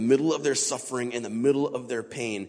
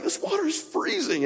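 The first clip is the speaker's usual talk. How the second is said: much higher, squealed gasp of shock; shocked at the cold. Retold or imitated. Swimmer yelping to his buddy about icy water, high squeal. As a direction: squeak it out like icy water just hit you. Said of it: "I was just like".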